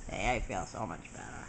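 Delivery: low pretend voice for the baby; voicing the baby. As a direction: answer for the baby, dropped very low. Answers in the baby's voice.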